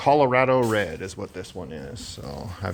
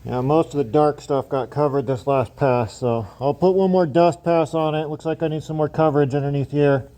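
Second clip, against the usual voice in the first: louder and higher through a respirator; through a face mask; 7 semitones higher, 4 dB louder than usual. Real, not acted. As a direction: talk through a paint mask. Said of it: "Hopefully you can hear me through my mask".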